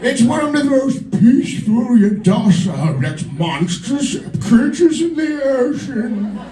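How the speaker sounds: slow, hammed-up British nature narrator; David Attenborough. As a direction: marvel at the wildlife as a documentary narrator, stretching the words. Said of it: "you know his voice".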